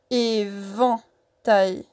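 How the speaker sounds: "Éventail" very slowly split as é, vent, tail; découpage en syllabes. Shown as a demonstration. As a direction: draw out and separate each syllable of "Éventail". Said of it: "la prochaine syllabe". (« Éventail » très lentement, syllabe par syllabe)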